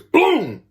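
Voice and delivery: sudden loud, high "boom"; imitated impact boom. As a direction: burst out a crash sound effect. Imitates sudden hit to ribs.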